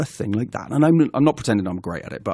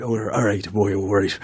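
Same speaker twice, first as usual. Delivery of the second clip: warming into a low Welsh accent; muttered practice at a Welsh accent; attempted Welsh accent; 4 semitones lower than usual. Shown as a demonstration. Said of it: "gotta get myself into it".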